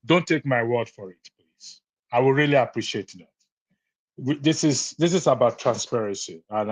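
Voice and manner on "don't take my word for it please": usual speaking voice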